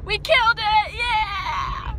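loud, high triumphant whoop; excited cheer. Real, not acted. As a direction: cheer it like a victory. Celebrating test.

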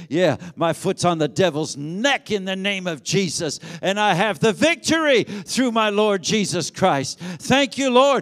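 stamp down and shout it; loud triumphant declaration. Real, not acted. Preacher leading the congregation's triumphant declaration, loudly. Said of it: "put your right foot down and say".

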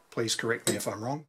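ordinary talk, nothing marked

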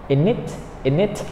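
in it run together as "ini-t", N joined to the vowel; 把 in it 连读成 "ini-t"，N 连到元音上; linked in it. Shown as a demonstration. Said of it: "when we speak fast".

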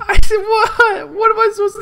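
high, crying yell; panicked cry. Real, not acted. Gamer panicking as neighbors die around him. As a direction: shout in helpless panic. Panicked yelling during raid.

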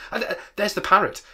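high, loud exclamation; thrilled shout at a sudden sight; excited cry. A narrator's reading. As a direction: call out in delighted discovery. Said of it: "cried Scrooge".

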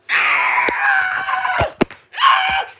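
long scream leaping high; terrified scream. Real, not acted. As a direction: scream at a jumpscare. Jumpscare screams.

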